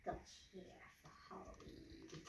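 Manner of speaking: secretive spooky whisper. Pretend. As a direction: lean in and whisper it. Mysterious question to children.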